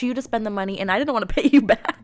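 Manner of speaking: high, loud, quick protest with a laugh; animated protest. Real, not acted. Friend protesting an offer to pay for her flight, animated. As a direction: object loudly, pitch up, laughing toward the end.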